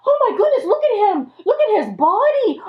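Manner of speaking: gasp, then high shocked exclamations; hammed-up horror, high-pitched; horrified shock. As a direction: act aghast at a dreadful sight. Friends shocked at seeing Job.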